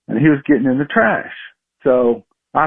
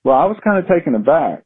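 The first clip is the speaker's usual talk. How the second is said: ordinary talk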